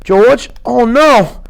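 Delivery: put-on worried childlike Lenny; a mildly panicked high voice; gently. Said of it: "He then said gently".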